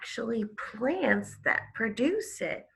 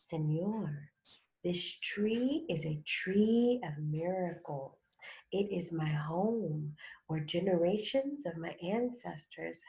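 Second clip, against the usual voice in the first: softer, low hissing snake voice; 3 semitones lower, 7 dB quieter than usual. Acted. Snake hisses to sleeping man, soft hissing. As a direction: lean in and hiss like a snake in his ear. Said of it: "hissed in his ear".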